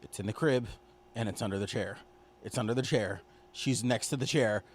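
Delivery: quiet, halting retold inner monologue; softly voiced looping thought; hushed inner thoughts. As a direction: think it aloud under your breath. Voices dread-filled thoughts of a viewer.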